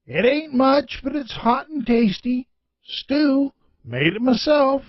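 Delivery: a hint of folksy old George offering stew. Old gas station owner offers a stranger stew, gently.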